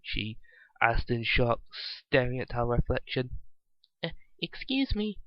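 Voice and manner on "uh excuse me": soft, higher small voice; a shy child pipes up timidly. A narrator's reading. Rabbit girl catching a stranger's attention, small voice. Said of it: "a small voice sa-, said from nearby".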